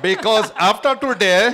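high, loud Indian accent. Shown as a demonstration. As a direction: carry on in a put-on Indian accent. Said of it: "do that one in Indian accent".

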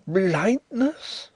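high, startled cry; jolted as though electrified; shocked exclamation. A narrator's reading. Visitor learns the host is blind, electrified surprise. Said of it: "exclaimed Drishna".

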